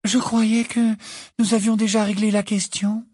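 voix plus haute, détachée, agaçante; exaspérante. Acted. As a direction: calme exaspérant d'une rêveuse. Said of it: "exaspérante". (Luna, l'air de rien, exaspérante)

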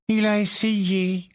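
put-on high, slow girlish voice; slow, bright young witch; Tonk's voice. Tonk answering Bill, in a high slow girlish voice. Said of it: "dit Tonk".

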